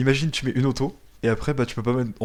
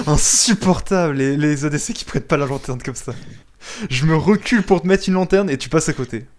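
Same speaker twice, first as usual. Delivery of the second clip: raised, higher-pitched complaint; exasperated outburst, voice raised; loud exasperation; 3 semitones higher, 5 dB louder than usual. Real, not acted. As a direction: rant loudly at a teammate who missed. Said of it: "Faut pas que je parle trop fort".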